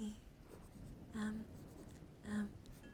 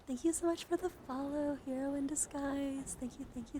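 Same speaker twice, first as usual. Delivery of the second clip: delighted thanks; 5 semitones higher, 4 dB louder than usual. Real, not acted. Thrilled greeting of a new follower.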